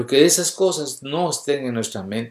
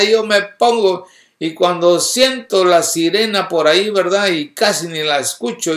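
loud, higher testimony from the pulpit; impassioned preaching; 6 semitones higher, 6 dB louder than usual. Real, not acted. Preacher telling of crying out to God in the car, impassioned. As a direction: testify with rising fervor.

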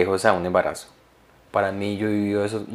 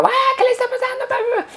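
imitated screaming. Husband imitating his crying wife mid-fight, mock scream. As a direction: squeal it like a hysterical outburst. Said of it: "ella gritando".

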